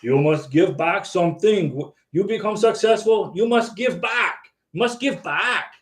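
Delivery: imitating an Austrian accent, emphatic; heavy Austrian-accented pronouncement, rising in pitch; Arnold Schwarzenegger. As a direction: give it a commanding Austrian-accent delivery. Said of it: "his Austrian accent".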